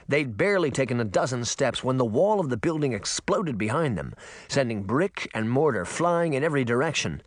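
usual speaking voice

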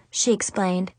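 ordinary delivery, nothing marked

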